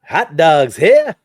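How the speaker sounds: loud, slow stadium hawker yell; hot dog vendor call. Shown as a demonstration. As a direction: bellow it like a ballpark vendor. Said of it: "let's hear your best hot dog stand guy".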